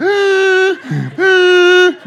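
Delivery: high-pitched burst of laughter; high, delighted laugh. Real, not acted. Laughs at own story.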